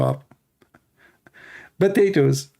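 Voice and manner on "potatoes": mocking. Mocking critics as potatoes.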